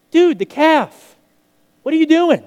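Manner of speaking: a brother who can't believe it; incredulous outburst. Acted. Older son to the returning servant, high incredulous outburst.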